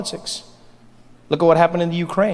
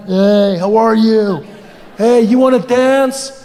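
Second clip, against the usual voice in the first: louder, drawn-out leering come-on; creepy guy; 4 dB louder, much slower than usual. Acted. Creepy guy grinding on a woman at a bar, drawn-out sleazy voice. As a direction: play a sleazy guy pestering for a dance. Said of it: "That creepy guy comes up".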